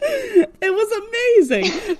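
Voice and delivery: high, slow delighted gush; excited. Real, not acted. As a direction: stretch it out thrilled. Gushing about scene.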